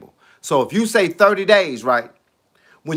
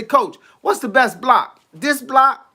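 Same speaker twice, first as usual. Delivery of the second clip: mock eager fan; 6 semitones higher, slower than usual. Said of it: "they say".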